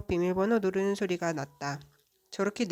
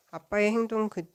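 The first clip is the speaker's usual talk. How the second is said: plain reading voice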